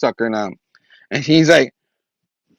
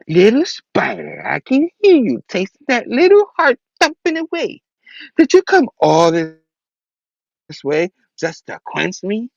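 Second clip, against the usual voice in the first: vampire villain; 6 semitones higher than usual. Acted. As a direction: toy with your prey like a vampire. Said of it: "And her vampire self".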